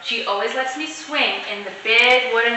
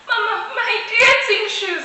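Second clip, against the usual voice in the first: high wail, mild upset; crying out; 11 semitones higher than usual. A narrator's reading. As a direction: cry out over ruined shoes. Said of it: "I cried".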